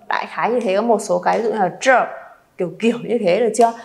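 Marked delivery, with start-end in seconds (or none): none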